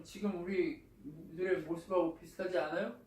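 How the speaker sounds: normal talking voice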